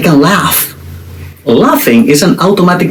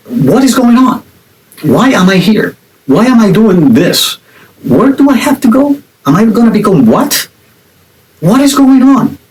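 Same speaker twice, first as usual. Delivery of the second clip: high-pitched, insistent string of baffled questions; acted-out exasperated confusion; bewildered questioning; 7 semitones higher than usual. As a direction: plead for an answer, pitch swooping up. Acts out bewildered questioning of life.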